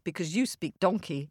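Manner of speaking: ordinary delivery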